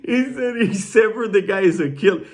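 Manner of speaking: high-pitched, still laughing; through laughter. Real, not acted. Storyteller amazed by an inmate's story, talking through laughter. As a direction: squeeze the words out after cracking up.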